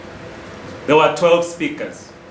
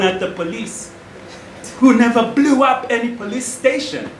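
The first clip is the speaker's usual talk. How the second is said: scornful challenge, pitch climbing higher; indignant rebuttal; 5 semitones higher than usual. Acted. Man rebutting a charge of jealousy over his activist brother, indignant. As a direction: throw the accusation back with rising scorn.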